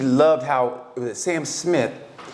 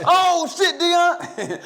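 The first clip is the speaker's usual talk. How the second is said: starstruck fan; much slower than usual. Retold or imitated. Fan recognizing [name] on the street, high mock shock. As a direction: gasp out the name like a dazzled fan. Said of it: "And I go".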